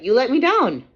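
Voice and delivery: loud, high, hurt cry; angry retort. Retold or imitated. Mother snapping at the CPS worker, loud and hurt. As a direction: throw the betrayal right back at her.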